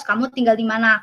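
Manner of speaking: usual speaking voice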